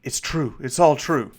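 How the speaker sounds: rising eager disbelief; overjoyed the legend is real; excited. Acted. Noble host to his guest, thrilled. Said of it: "He goes".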